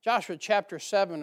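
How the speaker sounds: usual speaking voice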